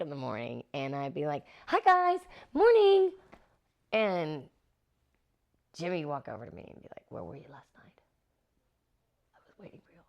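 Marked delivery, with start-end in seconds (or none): 1.6-3.1 high, loud, sing-out morning hello; cheery greeting. Retold or imitated. Actress greeting her castmates at work, cheery and loud. 7.1-10.0 menacing method-actor whisper; creepy whisper. Retold or imitated. Co-star confronting her off camera, intense hushed whisper. Imitates co-star's creepy in-character questioning.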